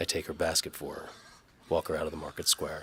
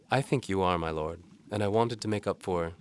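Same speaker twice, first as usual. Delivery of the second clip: higher, louder lightly voiced young woman; Galena's voice; 4 semitones higher, 5 dB louder than usual. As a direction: voice the maid brightly and politely.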